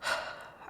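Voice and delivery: short audible exhale; breathy sigh. Acted. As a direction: sigh before correcting a misunderstanding. Boss misunderstood by employee.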